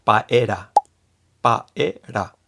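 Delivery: paera with a tapped r, no ll; Japanese-style paera. Shown as a demonstration. Teacher showing the English-based Japanese spelling. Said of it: "Entonces sería".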